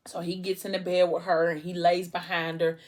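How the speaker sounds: plain reading voice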